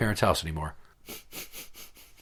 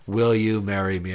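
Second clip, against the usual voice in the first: mock monotone marriage proposal; imitating a lifeless proposal; 4 semitones lower than usual. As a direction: drone the question without any lift. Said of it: "very monotone".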